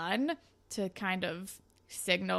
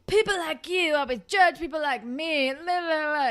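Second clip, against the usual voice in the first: higher, louder sing-song whine; mocking whiny voice; 7 semitones higher, 5 dB louder than usual. [name] complains to Claire, higher sing-song mockery. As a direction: parody his chip-on-shoulder rant. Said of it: "being like".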